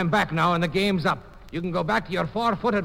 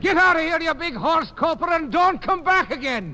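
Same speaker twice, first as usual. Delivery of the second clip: angry shout; 7 semitones higher than usual. Acted. Throwing rival out.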